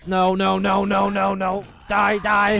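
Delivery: high, loud rapid repeats; panicked. Real, not acted. Player fighting off bats, panicked. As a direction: cry out frantically while fighting.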